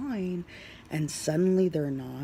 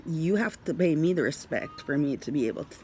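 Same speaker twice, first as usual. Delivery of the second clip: ordinary talk, nothing marked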